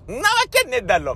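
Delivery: high, rapid mock scoff; comic incredulous outburst; disbelieving retort. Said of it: "みたいな顔して".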